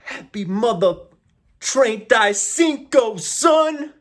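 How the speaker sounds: hyped shout. Pretend. Hyped Spanish birthday shout.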